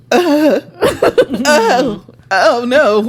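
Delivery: high, loud, breathless oh no through laughter; laughing panic. Retold or imitated. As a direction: caught off guard by her own tears, half laughing. Retelling sudden panic attack.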